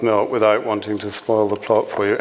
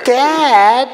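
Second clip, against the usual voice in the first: very high, loud whine to Dad; American daughter; 14 semitones higher, 7 dB louder than usual. Acted. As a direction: protest like an American kid to her father. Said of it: "said Linda".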